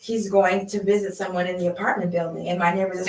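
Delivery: normal talking voice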